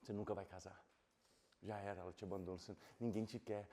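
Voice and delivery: low, soft taunting devil voice; devil's whisper. Retold or imitated. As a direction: hiss doubts like a devil in his head. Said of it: "o diabo falava na minha cabeça".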